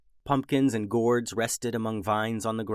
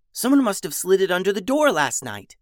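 put-on higher, bright-eyed girl voice; lightly voiced excited girl; eager Beatrice; 9 semitones higher, 6 dB louder than usual. A narrator's reading.

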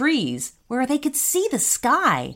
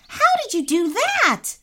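high-pitched amazed screech, moderate volume; 12 semitones higher than usual. A narrator's reading. Boy sees brother fling the robot, screeching. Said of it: "screeched Rami".

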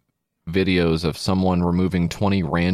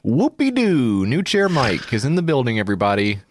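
sarcastic fanfare; 3 semitones higher than usual. Pretend. Mocking Mike's new chair to everyone, sarcastic hype. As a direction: announce it like a fake hype man.

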